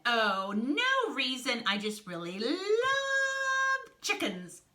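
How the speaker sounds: slow, high, overdone innocence; loud, sugary stretched-out alibi; feigned innocence. Acted. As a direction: draw it out high to cover a secret. Snake feigns innocence about chickens.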